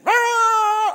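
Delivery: high, drawn-out yapping; imitated dog barking. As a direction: bark like a dachshund racing off. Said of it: "They're going and barking".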